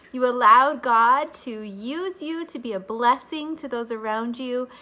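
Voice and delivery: usual speaking voice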